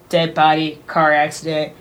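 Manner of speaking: normal talking voice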